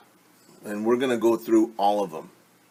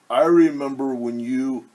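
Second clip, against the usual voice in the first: Turkey voice; 5 dB louder than usual. Acted.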